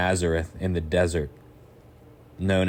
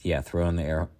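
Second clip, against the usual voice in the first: normal talking voice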